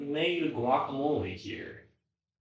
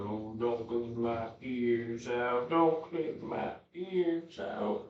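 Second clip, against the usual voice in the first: whiny dog voice; 2 semitones higher, slower than usual. Acted. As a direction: speak for the dog, whining. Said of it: "Oh, now you have to go".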